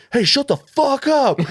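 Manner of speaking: high, slow, loud bellow of "shut the fuck up"; furious yell. Retold or imitated. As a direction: roar it at the heckler. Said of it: "like, yelling this".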